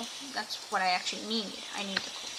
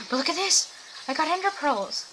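light up over the find; excited; 7 semitones higher than usual. Real, not acted. Streamer shows viewers her new ender pearls, higher and excited. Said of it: "I'm actually really excited about these".

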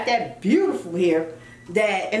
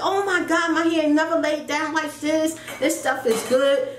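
retold gushing in a higher voice; a touch of amazed delight, raised pitch; impressed delight; 6 semitones higher than usual. Woman retelling her joy at her laid-down hair, impressed delight.

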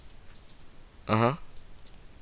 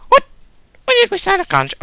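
very high, loud mock mother; squeaky mom voice; 8 dB louder, much faster than usual. Mother grounding her son, very high loud mock scolding. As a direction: screech it like a shrill mom grounding him.

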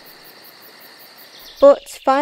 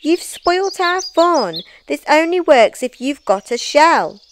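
high, complaining snail voices; scolding cry; 8 semitones higher, 5 dB louder than usual. A narrator's reading. Snails telling Norman he spoilt their fun, complaining. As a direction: whine at the one who spoilt the game. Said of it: "they cried".

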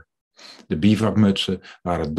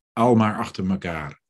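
usual speaking voice

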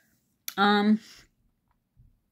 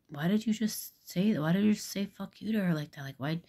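incredulous bystander; 3 semitones higher, 4 dB quieter than usual. Retold or imitated. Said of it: "looked at the one like".

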